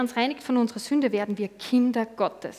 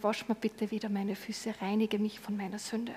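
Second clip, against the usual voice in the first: much softer, humble plea; a soft, humble prayer for cleansing; 9 dB quieter than usual. Acted. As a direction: pray it quietly and humbly. Quiet prayer to Jesus.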